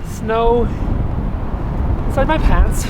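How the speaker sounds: loud and fast against the wind; shouting over wind. Real, not acted. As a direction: raise your voice over a gale. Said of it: "I hope you can hear me through the wind noise".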